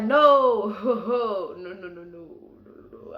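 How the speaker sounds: mock panic with a laugh; panicked pleading fading out. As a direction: beg no, no, fading to breathless. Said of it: "we was like".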